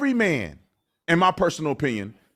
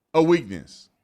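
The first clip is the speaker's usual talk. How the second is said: ordinary talk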